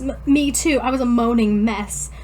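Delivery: ordinary talk, nothing marked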